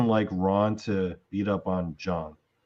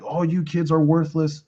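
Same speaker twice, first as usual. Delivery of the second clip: retold screaming; 7 semitones higher than usual. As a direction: rail at the worthless kids in a hammed-up father voice. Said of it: "Ron's screaming".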